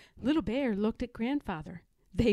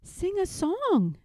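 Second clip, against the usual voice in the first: higher, slow, lilting urging; encouraging Mother Bear; 9 semitones higher, 3 dB louder than usual. A narrator's reading. Mother Bear to sad robin, coaxing slowly. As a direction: coax gently in a raised, drawn-out voice.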